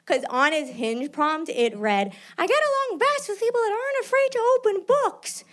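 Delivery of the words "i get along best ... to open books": high, sing-song reading of his profile; mock reading voice. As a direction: read it out like a silly high voice. Reading his dating profile line.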